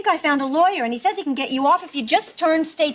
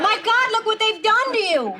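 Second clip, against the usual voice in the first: higher, insistent repeat; wife repeating herself through the glass, more insistent; repeating it louder; 6 semitones higher than usual. Acted. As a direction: say it again, pushing harder. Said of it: "I said".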